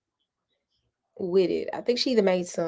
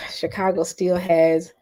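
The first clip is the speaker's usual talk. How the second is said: sighing whisper; 3 dB louder than usual. Real, not acted. Sighing about Chicago food.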